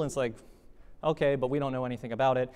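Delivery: ordinary talk, nothing marked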